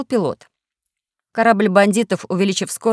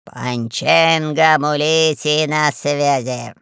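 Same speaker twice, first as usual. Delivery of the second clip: slow, drawn-out lazy call sign; heavy, languid master's voice; lazy fat boss; 3 semitones lower, much slower than usual. A narrator's reading. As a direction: answer sluggishly, stretching every word. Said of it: "лениво шевеля толстыми".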